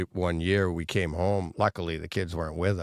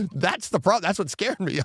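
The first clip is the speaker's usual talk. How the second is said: pitched up and worked up, retelling what he'd have done; telling his co-host he'd have charged the vandals, worked up; high excited retelling; 11 semitones higher than usual. Retold or imitated. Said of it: "I'm like".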